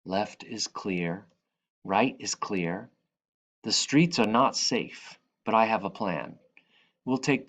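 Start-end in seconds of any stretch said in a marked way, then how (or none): none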